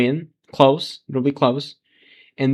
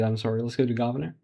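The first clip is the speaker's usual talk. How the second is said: ordinary delivery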